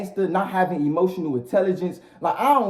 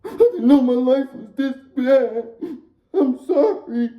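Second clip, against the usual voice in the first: high, slow hammed-up sobbing; mock crying; 8 semitones higher, slower than usual. Defendant pleading in front of the judge, mock crying. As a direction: blubber a fake tearful apology, high and drawn out.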